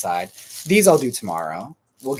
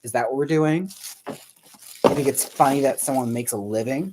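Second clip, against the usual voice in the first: mock indignant; 4 semitones higher, 4 dB louder than usual. Mock scolding of John.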